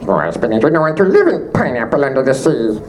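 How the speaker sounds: buzzy insect robot voice with a laugh; Waspinator voice. Acted. Voice actor mashes Waspinator into the SpongeBob theme, laughing. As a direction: goof on the Waspinator voice, half laughing.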